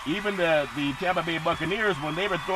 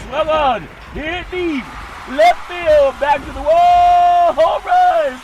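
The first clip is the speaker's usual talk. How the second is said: building play-by-play, rising to a shouted higher call; announcer calling a homer to the wall, louder each phrase; home run call; 10 semitones higher, 9 dB louder, much slower than usual. Acted. Sportscaster to the crowd, building to a roaring home run call.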